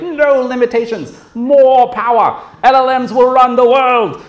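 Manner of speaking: AI hype zealot. Retold or imitated. Mocking AI hype people as zealots, high slow evangelist voice. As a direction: preach AI like a starry-eyed zealot.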